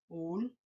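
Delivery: "Últimos" softly as úl, ti, mos; three low, quiet, separated beats for "Últimos"; syllable by syllable. Shown as a demonstration. Teacher repeating the count, "Últimos" quietly syllable by syllable. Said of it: "Tiene tres sílabas".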